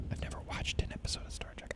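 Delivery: hushed aside; low whispered confession. Real, not acted. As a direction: whisper it to the audience. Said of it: "whispered aside to viewers".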